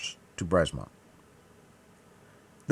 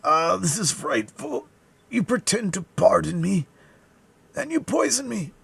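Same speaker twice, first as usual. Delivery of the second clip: agonized dying man; 12 semitones higher, 4 dB louder than usual. A narrator's reading. Dying man accusing his poisoner, high agonized cry. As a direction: accuse him through your agony. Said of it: "a dying man were distorted with agony".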